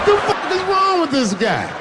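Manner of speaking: incredulous yell. Acted. Comic railing at Trump, high laughing yell of disbelief. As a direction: cry out in disbelief.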